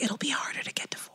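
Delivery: quick, hushed whisper; whispered inner thought. Retold or imitated. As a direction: whisper it like a guilty secret. Retells private thought, confessing.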